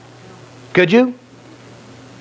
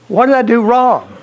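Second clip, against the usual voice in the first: high-pitched, bewildered husband; acted wounded protest; mock baffled protest; 9 semitones higher than usual. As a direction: plead innocence in a raised voice. Punched husband protests to wife.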